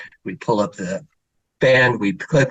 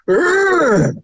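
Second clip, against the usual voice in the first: high, mock-frustrated toddler growl; little girl growling at her pants. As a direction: voice the child's furious little groan, pitched up. Toddler's frustrated outburst retold.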